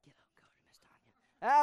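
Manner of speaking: whispered name, as if sharing a secret; gossip whisper. Retold or imitated. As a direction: whisper it behind a hand. Gossip voiced in sermon.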